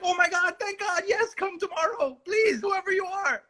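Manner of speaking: high mock relieved gush; relieved Lisa. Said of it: "she was like".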